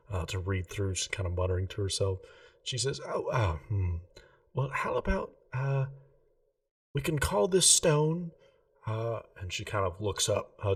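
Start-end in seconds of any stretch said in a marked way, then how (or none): 3.0-8.3 a lightly voiced, slightly higher shopkeeper, polite and earnest; a hint of a prim gem-shop lady, keen on geometry. Remy thinking up a name.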